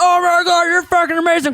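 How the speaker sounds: high, loud mock gushing; gushing fan. Drunk fan gushing over the comic, high and loud. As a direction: rave like a drunk superfan.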